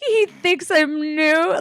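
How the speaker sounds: high, drawn-out mock wail; upset whine. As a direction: whine it slowly, mortified, pitch way up. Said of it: "And I was so upset".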